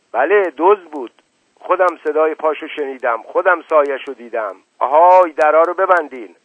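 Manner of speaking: frightened, then bellow the order; alarmed. Acted. Uncle frightened, orders doors shut. (هراسان و با صدای بلند)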